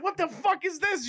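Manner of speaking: very high, panicked disbelief, mimicked; retold freak-out. Retelling him freaking out at the laptop, mock panic. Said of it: "he's freaking out before".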